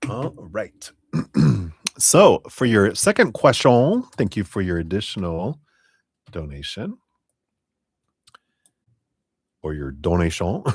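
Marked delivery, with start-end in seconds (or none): 2.0-10.6 hammed-up French accent, "donation" said "toʊnation"; playful fake French accent while thanking a donor; bad French accent. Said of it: "pretending to be someone with a bad French accent".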